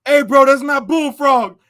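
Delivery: high, loud and slow; suspicious inmate. Retold or imitated. Inmate upstairs exposing the fake Bullfrog, high, loud and slow. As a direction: call out the fake. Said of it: "the dude upstairs was like".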